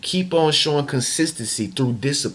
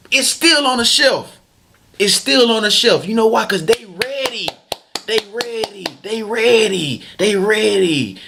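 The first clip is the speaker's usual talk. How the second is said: excited emphasis; 9 semitones higher, 3 dB louder than usual. Real, not acted. Hyped selling point, higher voice.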